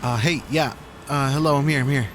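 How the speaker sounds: loud phone answer. Acted. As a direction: call out over the noise. Answering phone amid chaos.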